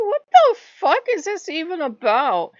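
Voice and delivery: loud, high exasperated outburst; outraged. Retold or imitated. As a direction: blurt it out in disbelief. Outraged retold reaction.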